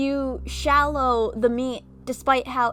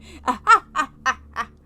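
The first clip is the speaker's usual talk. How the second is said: loud burst of laughter; 3 semitones higher, 7 dB louder than usual. Real, not acted. Gets the song joke.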